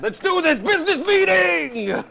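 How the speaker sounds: high, loud hype yell; hammed-up party shout; excited shout. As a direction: pump up the room with a shout. Sketch character yelling.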